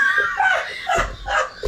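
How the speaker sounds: a high squeal of laughter; high shrieking laugh. Real, not acted. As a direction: burst out in a high, shouting laugh.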